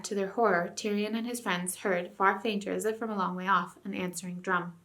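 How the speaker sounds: plain reading voice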